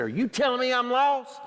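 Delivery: a touch of angry indignation; mock angry retort. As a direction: act out the friend's offended snap at him. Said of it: "He got so angry".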